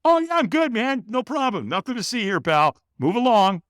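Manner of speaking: high, brushing-off fake cheer; feigned breezy denial. Hider fobs off the approaching trainer, breezy fake okay. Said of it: "I lie".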